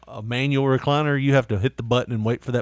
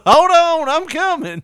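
high loud holler; calling to the door; 8 dB louder, slower than usual. Retold or imitated. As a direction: shout toward the door, much higher. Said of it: "I'm like".

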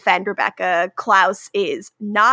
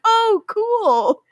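mock cheerful; 10 semitones higher than usual. Marcel's awkward reply, retold.

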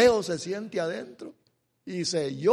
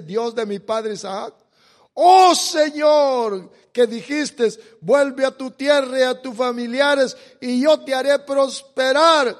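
louder, higher pleading with a long drawn "oh"; impassioned prayer; 5 semitones higher, 9 dB louder than usual. Acted. Jacob reminding God of his promise, loud impassioned prayer. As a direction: call on God with fervour.

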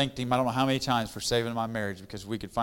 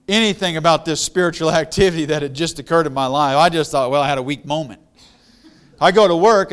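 louder, higher preacher's fervor building; preaching; 5 semitones higher, 9 dB louder than usual. Acted. Preacher tells the congregation how his wife's faith silenced him, fervent preaching. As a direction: preach it out, swelling with conviction.

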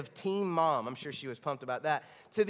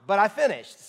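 much louder proud burst; triumphant; 4 semitones higher, 10 dB louder than usual. Real, not acted. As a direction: shout it like a victory. Proud self-mocking punchline.

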